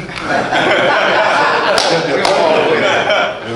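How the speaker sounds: a real amused laugh, falling away; short warm chuckle. Real, not acted. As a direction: laugh at the irony of it. Laughs at his own story.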